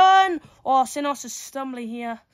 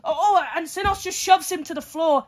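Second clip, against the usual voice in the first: usual speaking voice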